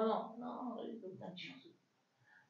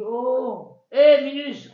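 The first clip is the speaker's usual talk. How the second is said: loud retort; 12 dB louder, much slower than usual. Acted. Laban retorts to Yaakov.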